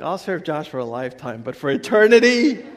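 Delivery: mock wife, jumping high and loud at the end; playfully voicing his wife turning him down, laughing; imitating wife. Preacher jokes his wife wants no marriage in heaven, laughing. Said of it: "she's like".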